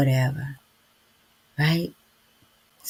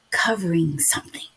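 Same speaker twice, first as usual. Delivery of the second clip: whispered. Real, not acted. Telling viewers what the addiction hid, whispered. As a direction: let the word fall into a whisper.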